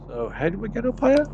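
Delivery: put-on quick and high, eager young acolyte. Eager acolyte pressing the navigator, high and quick. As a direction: rattle it off high and keen.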